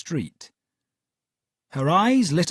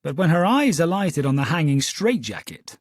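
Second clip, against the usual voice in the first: ordinary delivery, nothing marked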